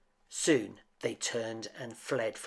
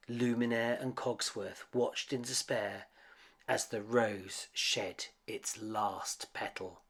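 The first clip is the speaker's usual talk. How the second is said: ordinary delivery, nothing marked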